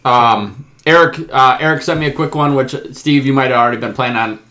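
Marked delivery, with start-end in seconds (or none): none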